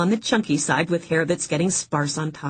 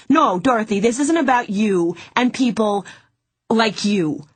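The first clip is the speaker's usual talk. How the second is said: higher, louder, furious lecture; angry rant; 6 semitones higher, 4 dB louder than usual. Acted. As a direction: tell her off hard. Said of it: "yell at a client".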